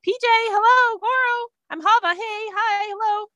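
high-pitched, eager hellos to everyone; excited greeting. Acted. Hava runs around greeting people, excited and high. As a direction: call out brightly, hunting for friends. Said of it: "I'll probably just run around saying".

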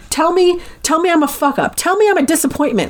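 high-pitched mock yelling without the volume; retold yelling. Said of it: "yelling at her all of these things".

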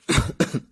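sudden choking cough. Real, not acted. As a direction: cough hard, caught in the throat. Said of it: "I'm choking".

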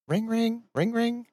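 high, soft sing-song ring ring; phone ring imitation. Player fakes a phone ringing, high and soft.

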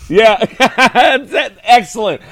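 high, loud laughing through words. Real, not acted. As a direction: crack up while talking. Laughing at the impression.

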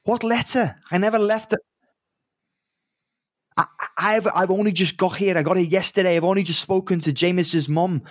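high, hurried, bewildered rant; flustered barman. Acted. Barman protests he never sent a letter, high and flustered. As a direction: panic at being found out.

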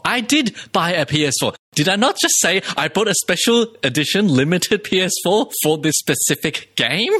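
steam-out-of-the-ears exasperation, half playful; worked-up, fuming. Real, not acted. Gamer protesting to co-host about buying a console, fuming. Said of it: "Steam is coming out of [name]'s ears".

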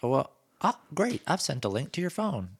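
high, loud, singsong assistant parody; mock an over-helpful digital assistant; Google assistant. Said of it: "it sounds just like that".

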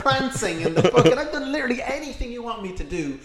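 loud, exasperated listing; mock exasperated rant. Friend voices Fallon complaining he did everything, loud exasperated rant. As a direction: rant like he's fed up. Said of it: "And he's like".

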